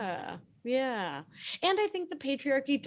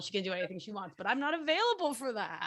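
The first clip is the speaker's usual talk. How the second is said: ordinary delivery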